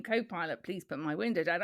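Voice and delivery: low-key request to the passenger; instructing co-pilot. Acted. Driver to co-pilot at the window, quiet request. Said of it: "and I'm saying".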